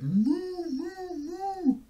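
three long, high moos; mooing. A narrator's reading. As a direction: moo like a cow. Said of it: "the duck on the pond said".

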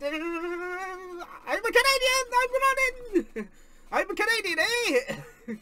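hammed-up Canadian accent, slow and high; a playful Canadian voice for the returning wrestler; mock Canadian. Streamer mocking a Canadian wrestler's entrance, playful Canadian accent. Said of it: "Legitimately just like".

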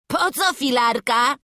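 cocky, lightly amused question; cheeky amusement. A narrator's reading. Daredevil boy answers the master from above, cheeky. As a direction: toss it down cheekily. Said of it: "Zaśmiał się zuchwalec z góry".

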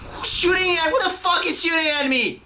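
very high, squealed indignation; panicked outrage. Real, not acted. Player under fire from an unseen shooter, panicked outrage. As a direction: demand it in a high, frantic yelp. Gamer shot by unseen enemy.

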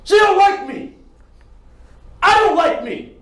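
high, loud desperate yelling; anguished shouting. Acted. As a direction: shout out the confession in despair. Audition monologue, shouted confession.

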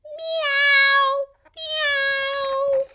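high, long meows; cute drawn-out kitten cries; kitty meow. Pretend. Cat sound for kids.

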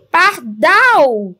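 pardal called slowly, DAL long and strong; stress on DAL. Shown as a demonstration. Teacher showing the last syllable is strong, pardal stressed on DAL. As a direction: stretch and punch DAL in pardal. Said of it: "a última sílaba é forte".

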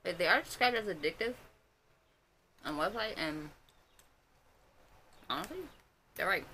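speaking behind fingers while chewing; muffled through hand; hand over mouth. Real, not acted. Seen 3: Fingers cover mouth throughout, likely chewing snack behind hand.

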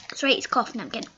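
ordinary talk, nothing marked

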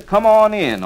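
loud come on in duck call; duck call honks. Shown as a demonstration. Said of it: "next call to use".

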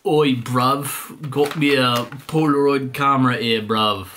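cockney geezer voice; jokey London accent, oi bruv; rough london slang. Pretend. It's made in the U K.

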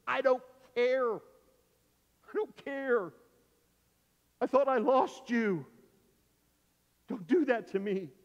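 high, loud, stretched-out outburst; overwrought father. Retold or imitated. Dad clutching the son he thought lost, overwrought. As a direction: cry it out with desperate, emotional force.

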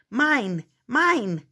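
clamoring. Acted. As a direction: keep grabbing with each "Mine". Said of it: "they clamored".

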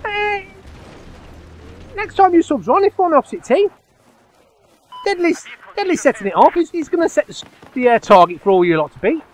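high, loud call to the lobby; real call-out to other players. Real, not acted. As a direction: announce it to everyone listening.

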